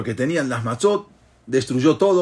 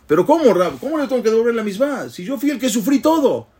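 incredulous protest; 10 semitones higher than usual. Retold or imitated. Survivor protests the rabbi's ruling.

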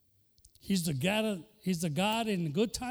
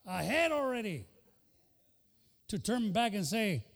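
fired-up preaching; 4 semitones higher than usual. Acted. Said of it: "vamos a enseñarle".

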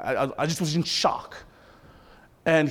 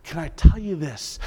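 ordinary delivery

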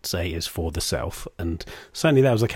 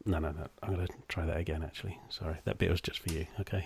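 murmured off-mic aside, much softer; quiet aside; 3 semitones lower, 13 dB quieter, much faster than usual. Real, not acted. Host quietly aside to guest before retaking the intro, hushed. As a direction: drop the voice for a private aside.

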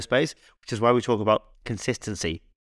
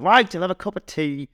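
higher bossy housekeeper voice; Mrs Doyle; 7 semitones higher, 3 dB louder than usual. Retold or imitated. Mrs Doyle deciding for the priest, lightly voiced bossy. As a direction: settle it firmly, a bit high. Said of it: "And he's like".

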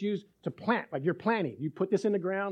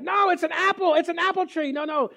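higher and louder, pleading; husband protesting to his doubting wife, higher and louder; insistent protest; 9 semitones higher, 5 dB louder than usual. Retold or imitated. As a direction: insist hard it really is a tree. Insisting it's an apple tree.

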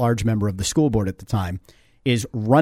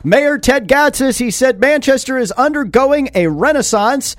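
upbeat radio ad read, higher and louder; 10 semitones higher, 4 dB louder than usual. Acted. Radio host reading the mayor's sponsor spot, upbeat ad voice.

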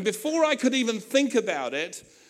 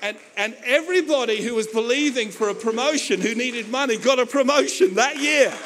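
rising, louder to a high triumphant finish; building excitement; 4 semitones higher, 6 dB louder than usual. Real, not acted. Preacher building to the climax of his testimony, excited and loud. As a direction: build it up into a shout of victory.

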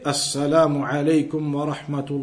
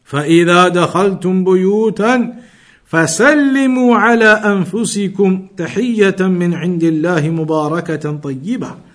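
brisk, measured recitation of the verse, a little louder; Quran recitation; 2 semitones higher, 4 dB louder, much faster than usual. Acted. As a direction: recite the ayah quickly and evenly.

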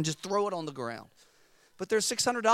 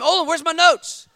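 loud, high panicked demand; mock freak-out; 11 semitones higher, 12 dB louder than usual. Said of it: "I'm freaking out".

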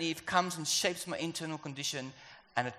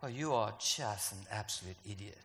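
darkness voice; 7 semitones lower, 7 dB quieter than usual. Acted. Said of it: "the language of the kingdom of darkness".

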